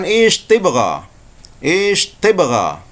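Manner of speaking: slow, drawn-out Saudi Arabic word; Saudi Arabic accent. Shown as a demonstration. Teacher demonstrating Saudi Arabic, very slow. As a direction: stretch it out like a Saudi speaker. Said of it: "Orang Saudi akan mengatakan".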